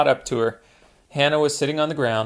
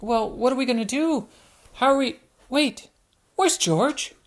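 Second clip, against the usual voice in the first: high, anxious little piglet; suddenly miss her brother; worried Peppa; 11 semitones higher than usual. Acted.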